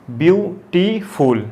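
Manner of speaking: Beautiful slowly as view, टी, फुल; syllable by syllable. Shown as a demonstration. Teacher showing a three-syllable word, beautiful slowly broken up. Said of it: "तीन syllable वाले word".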